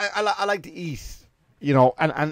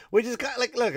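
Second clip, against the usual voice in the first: laughing; 11 semitones higher, 5 dB louder, faster than usual. Real, not acted. Laughs at his own joke.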